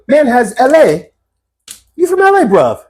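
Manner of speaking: high, stretched English mock questioning; imitated English accent. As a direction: quiz him in a hammed-up English accent. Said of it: "I love your accent".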